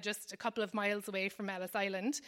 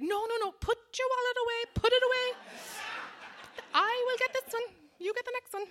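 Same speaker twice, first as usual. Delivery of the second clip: put-on much higher Irish accent, insisting on paying; 13 semitones higher, faster than usual. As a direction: play the pushy Irish host grabbing the bill in an Irish lilt. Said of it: "the Irish person in me would definitely come out".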